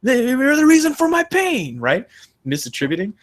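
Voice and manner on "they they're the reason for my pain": high, mock-furious accusation; hammed-up high blaming whine, half-shouted; playing the hurt victim blaming a bystander; mock angry blame. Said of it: "you look at the person who pulled it out and you're like".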